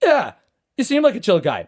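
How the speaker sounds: high, sudden agreeable turnaround; genuine, disarmed. Retold or imitated. Disarmed driver to the apologetic parker, genuine agreement. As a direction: imitate an angry man suddenly won over. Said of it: "not in a sarcastic way, like an actual genuine".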